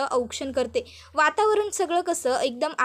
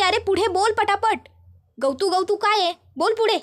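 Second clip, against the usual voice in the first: raised, higher, louder urging; impatient angry; 5 semitones higher, 3 dB louder than usual. Acted. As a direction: hurry him up, losing patience. Gautami impatiently urges Charlie. (वैतागून जोरात उंच आवाजात घाई करणे)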